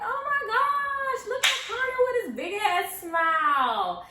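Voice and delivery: high, loud, drawn-out mock squeal; excited outburst. Student exclaiming over a friend's photo, high excited outburst. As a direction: stretch it out in high, loud amazement.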